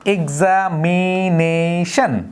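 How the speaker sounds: Examination very slow, ex-am-i-na-tion split apart; syllable by syllable. Shown as a demonstration. Teacher slowly splitting examination for the class. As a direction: stretch out each beat of examination. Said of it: "যেমন আমরা যদি বলি".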